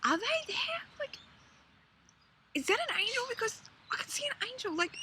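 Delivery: asking in awe; amazed disbelief. Retold or imitated. Staring at angels in the desert sky, amazed. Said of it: "we keep on rubbing our eyes going".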